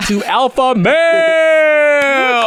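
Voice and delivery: high, drawn-out announcer yell; show intro shout. Pretend. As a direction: belt out the show's name, stretching the last word.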